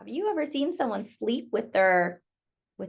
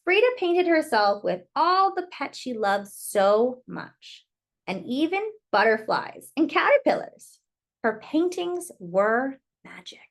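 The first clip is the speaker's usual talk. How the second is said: story narration. Acted. Reading Frida's story to children, lilting storyteller voice. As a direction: tell it like a bedtime picture book. Reads picture book aloud.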